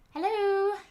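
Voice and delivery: bright, high breezy "Hello"; breezy and polite. A narrator's reading. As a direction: sound breezy and polite to a stranger. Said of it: "tried to sound breezy and polite".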